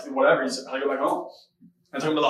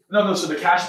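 usual speaking voice

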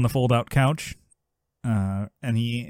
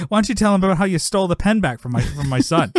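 higher, quick retold outburst; mock angry yell; 7 semitones higher, 4 dB louder, faster than usual. Man stands up to accuse Jack at the roast, mock yell. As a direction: play an accuser heckling from the audience. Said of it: "stands up, and yells about Jack".

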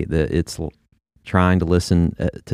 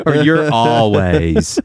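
mock accusation; 2 semitones higher, much slower than usual. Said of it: "when you're mad at somebody".

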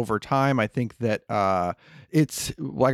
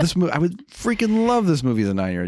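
pitched-up, eager insistence; excited insistence; 8 semitones higher than usual. Real, not acted. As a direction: gush about loving it. Enthusiastic defense of movie.

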